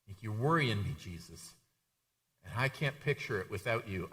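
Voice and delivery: soft, low private plea; acted confidential concern, voice dropped; hushed, worried appeal. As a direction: take a friend aside and quietly urge him. Acted Peter's private word aside.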